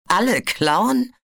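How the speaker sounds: incredulous, contemptuous hissed echo; scornful hiss. A narrator's reading. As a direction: sneer the words back at him. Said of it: "zischte Cirrus".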